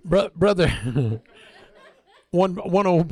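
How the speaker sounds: breaking into laughter mid-sentence; cracking himself up; laughing. Real, not acted. Preacher laughing at his own bit, real laughter.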